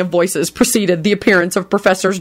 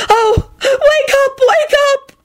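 very high, slow, tearful wail; frantic sobbing plea; slower than usual. Acted. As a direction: beg through sobs. Woman begs limp cat wake.

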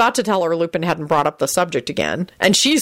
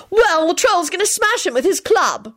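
very high, determined retort; high girlish defiance; 13 semitones higher than usual. A narrator's reading. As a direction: answer the challenge with resolve. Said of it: "Hermione responded determinedly".

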